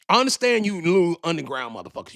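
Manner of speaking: ordinary talk, nothing marked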